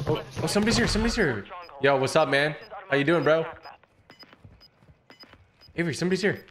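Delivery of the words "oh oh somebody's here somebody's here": excited. Real, not acted. Teammate spots another player.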